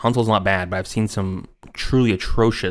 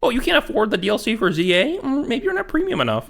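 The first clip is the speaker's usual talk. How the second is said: much higher snooty mock voice; condescending; 13 semitones higher than usual. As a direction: sneer down at poor gamers. Mocking a smug executive.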